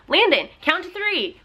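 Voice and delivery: slow, high singsong request; coaxing a toddler. Retold or imitated. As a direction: prompt a small child, stretching the words. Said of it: "I'll be like".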